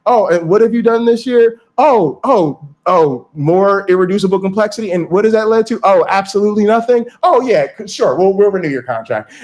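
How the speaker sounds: mildly mock the yearly reviewer who lets it slide; mock review committee. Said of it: "go up for review and be like".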